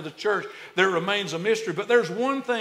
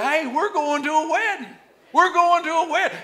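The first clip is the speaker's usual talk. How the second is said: higher, bright call to the neighbor; excited announcement; 10 semitones higher, 4 dB louder than usual. Shown as a demonstration. Preacher leading the church to tell neighbors, excited. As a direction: tell your neighbor the good news. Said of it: "look at your neighbor and say".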